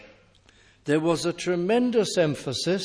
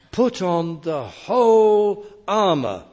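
"whole" hit hard and loud, rest lighter; stress on WHOLE; 4 semitones higher, 8 dB louder than usual. Shown as a demonstration. Preacher driving home the Greek emphasis, stress on whole. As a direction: lean into "whole" as the key word. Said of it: "is the emphasis".

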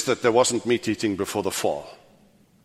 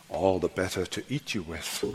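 hushed, lower storybook wolf; a hint of the wolf, low and soft; lightly voiced wolf; 6 semitones lower, 11 dB quieter than usual. Quotes fairy tale wolf to listeners.

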